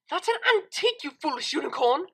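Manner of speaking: outraged at someone touching her cello; angry hiss. A narrator's reading. Cellist furious at the unicorn playing her cello, angry hiss. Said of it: "she hissed under her breath".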